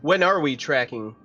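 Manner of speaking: ordinary talk, nothing marked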